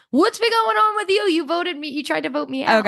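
a mock-petulant Austin, lightly sneered. Mocking Austin's petulant complaint to Julie, high whiny voice. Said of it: "It's like".

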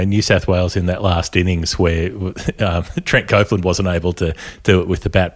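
ordinary talk

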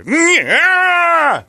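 imitating the newsman's pained yell; mock scream. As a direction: yell like you just tore a hamstring. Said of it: "That was the noise he made".

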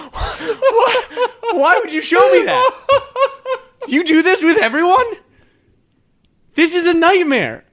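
high horrified laughing; disgusted laughter. Real, not acted. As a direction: laugh in shocked disgust, pitch way up. Laughing in horror at video.

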